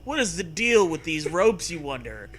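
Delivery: normal talking voice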